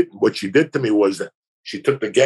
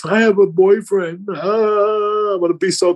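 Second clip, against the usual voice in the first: put-on girl's guilty protest; 8 semitones higher, slower than usual. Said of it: "and she goes".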